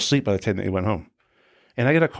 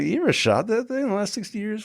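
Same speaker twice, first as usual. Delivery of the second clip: put-on high, disbelieving jab at old cops; mock them with a high sceptical question; incredulous mock question; 11 semitones higher than usual. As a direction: skeptical teasing pitch. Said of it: "I'm like".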